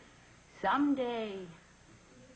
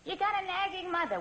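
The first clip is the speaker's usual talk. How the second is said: ordinary delivery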